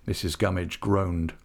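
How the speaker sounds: plain reading voice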